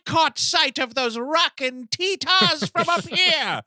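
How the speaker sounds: put-on high old lady, slowing at the end; hammed-up scolding mother in falsetto; Norma Bates. Mother catching her naughty boy, mock-scolding old lady. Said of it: "you just hear over the P A".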